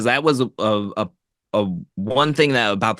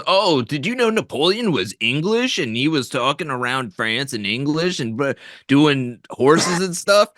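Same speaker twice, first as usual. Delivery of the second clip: higher, put-on smug commenter voice; mock YouTube commenter; 5 semitones higher than usual. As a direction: parody a know-it-all commenter. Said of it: "in the YouTube comments was".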